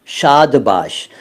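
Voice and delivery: शाद बाश drawn out very slowly, both words separate; slow "shaad baash". Shown as a demonstration. Teacher spelling out the origin of शाबाश, very slowly. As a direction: stretch शाद, pause, then बाश. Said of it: "शाद का मतलब खुश".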